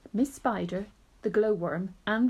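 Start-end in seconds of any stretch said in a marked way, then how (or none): none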